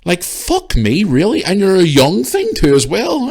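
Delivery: high, loud exasperation; exasperated outburst. Retold or imitated. Shopper fuming at a woman in the queue, exasperated outburst. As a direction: raise pitch and volume in disbelief. Retelling an argument in store.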